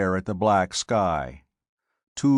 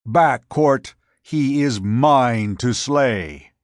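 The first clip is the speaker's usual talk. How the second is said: loud warning, raised well above the narration; roared warning; 3 semitones higher, 6 dB louder, slower than usual. A narrator's reading. Scipio warns Cort off his opponent, roaring. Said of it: "his deep voice roared a warning".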